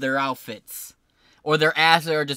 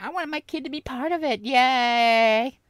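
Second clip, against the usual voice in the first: high, mocking mom voice; 10 semitones higher than usual. Streamer mocking parents at the event, high mocking voice. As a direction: sneer it like a giddy parent. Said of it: "women who were into".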